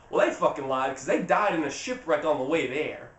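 ordinary delivery

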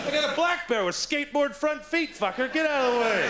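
yelling at a hoodie to clear off; defiant yell. Acted. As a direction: shout him down from above. Shouting back at hoodie.

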